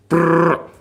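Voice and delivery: high rolled burst of gunfire; mouth-made automatic fire; imitated war noise. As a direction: trill a short rapid-fire burst. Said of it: "is how noisy it is".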